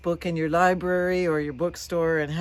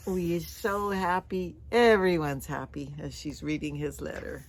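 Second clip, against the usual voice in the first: usual speaking voice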